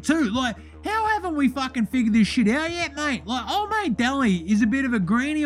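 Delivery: brash bogan voice throughout; Deli character voice. Acted. Host plays the character Deli.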